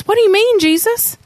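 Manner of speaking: high, pleading overwhelmed father; a desperate dad asking Jesus what he means. Retold or imitated. Dad pleading with Jesus, high and strained. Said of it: "can you imagine the dad being like".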